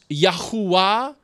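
Yahuwah said as yah-hoo-AH; Yahuwah pronunciation. Shown as a demonstration. Preacher offering another possible reading of God's name, Yahuwah said yah-hoo-ah. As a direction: say Yahuwah with "hoo" in the middle, rising at the end. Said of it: "if it's pronounced".

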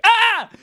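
high, loud startled shout. Retold or imitated. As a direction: yell as the mask hits. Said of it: "and I shouted".

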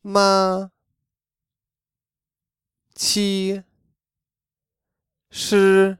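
Ma, qi, shi held high and level; high flat first tone on Ma, qi, shi. Shown as a demonstration. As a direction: keep Ma, qi, shi high and straight. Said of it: "try to keep the straight line".

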